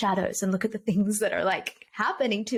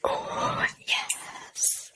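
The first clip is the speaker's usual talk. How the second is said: whispered; 4 dB quieter than usual. Real, not acted. Delighted at the question asked.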